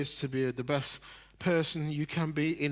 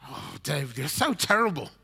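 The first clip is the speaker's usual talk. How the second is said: mock stern parent; 7 semitones higher, 6 dB louder than usual. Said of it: "I expected him to tell me".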